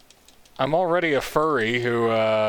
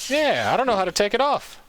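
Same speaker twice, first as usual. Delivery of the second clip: high-pitched repeat; 9 semitones higher than usual. Real, not acted. Repeats line, pitch jumps up.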